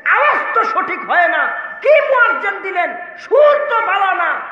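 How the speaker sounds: complaining worshippers. Retold or imitated. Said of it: "সব মুসল্লিগুলি আইসা বলতেছে".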